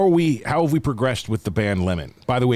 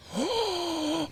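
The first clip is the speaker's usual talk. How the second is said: surprised gasp; 8 dB quieter than usual. Retold or imitated. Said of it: "when I get surprised I talk British".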